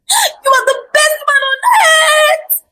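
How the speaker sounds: high-pitched overjoyed squeal, voice soaring; crying and laughing. A narrator's reading. Bride thanks her husband for the luxury car, crying and laughing. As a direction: squeal it out, overwhelmed with joy. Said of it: "already crying and laughing at the same time".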